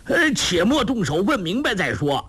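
high-pitched, urgent call to halt; insistent shout to hold off. A narrator's reading. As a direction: cut in high to stop the fight. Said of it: "彭连虎连叫".

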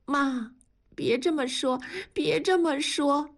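high, pleading through tears; tearful. A narrator's reading. Daughter-in-law comforting her mother-in-law, tearful. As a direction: gently stop her while crying. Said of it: "流着泪说".

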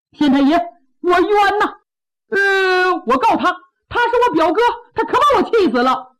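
aggrieved complaint. Acted. Plaintiff angrily complains in court.

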